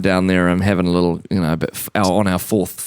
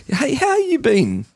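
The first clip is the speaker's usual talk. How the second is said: very high, drawn-out put-on greeting; hammed-up awkward small talk; mock friendly opener; slower than usual. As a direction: stretch it out in an overeager high voice. Acting out awkward opener to player.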